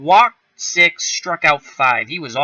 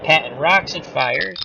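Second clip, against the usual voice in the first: normal talking voice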